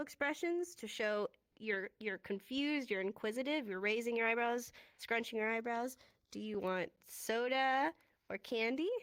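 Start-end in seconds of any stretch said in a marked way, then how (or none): 6.3-8.9 slow question rising on candy; teacher demos asking with a rising tune; question rise. Shown as a demonstration. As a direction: lift candy at the end like a real question. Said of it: "You're asking a question".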